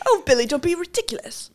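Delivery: high, quick hammed-up posh scolding; mock period-drama wife, laughing; posh Titanic lady. Pretend wife chides Billy on the ship, mock posh.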